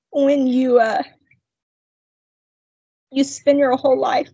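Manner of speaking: real tears held back, long pauses to regain control; choked up. Real, not acted. Woman testifying about no longer relying on herself, choked up. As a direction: push on haltingly through tears. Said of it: "I'm gonna cry".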